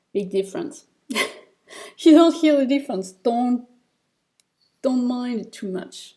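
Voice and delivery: usual speaking voice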